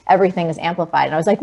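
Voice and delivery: ordinary talk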